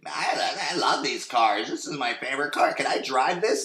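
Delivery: lightly voiced giggly girl; giggly blonde girl. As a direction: act the ditzy blonde gushing over the car. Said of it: "the young blonde's like".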